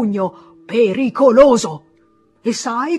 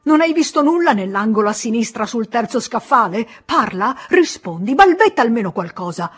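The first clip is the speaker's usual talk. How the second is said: angry scolding; 3 semitones higher, 6 dB louder than usual. Acted. Pharmacist furious at the boy for entering the storeroom, loud angry scolding. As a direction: tear into the careless boy.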